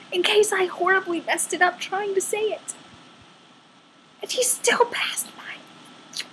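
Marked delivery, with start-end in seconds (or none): 4.2-6.2 whisper. Real, not acted. Driver passes her stop.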